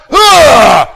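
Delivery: loud shouted exasperated "Ugh"; frustrated groan. Retold or imitated. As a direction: blurt out an angry groan. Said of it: "You got the vocal".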